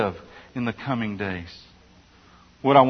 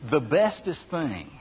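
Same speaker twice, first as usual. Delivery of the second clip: playful bad grammar; 4 dB quieter than usual. Real, not acted. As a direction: lean into "bestest" with a wink. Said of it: "can I not be proper grammatically here?".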